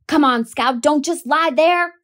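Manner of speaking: high loud frantic cry; screaming. A narrator's reading. Brother screaming at his sister frozen on the ground, loud and high. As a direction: scream at her to get up now. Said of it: "Jem was screaming".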